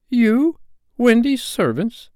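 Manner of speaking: high, loud shocked questions; drawn-out high-pitched amazement; incredulous. A narrator's reading. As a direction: repeat it in astonished disbelief. John astonished at boys serving Wendy.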